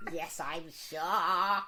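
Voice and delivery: slow, drawn-out confirmation; deliberate, exasperated reply; strained patience. Retold or imitated. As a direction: spell it out wearily to a doubter. Answering repairman's repeated doubt.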